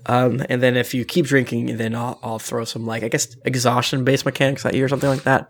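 normal talking voice

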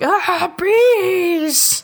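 high, drawn-out mock scream; agonized wail. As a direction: wail it like a hammed-up victim in agony. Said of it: "they're in so much agony".

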